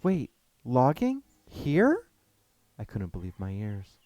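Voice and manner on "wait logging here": high, loud, slowed disbelief; rising up in outrage at the word here; shocked. Acted. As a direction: narrator stunned, voice shooting up. Shocked at news of logging.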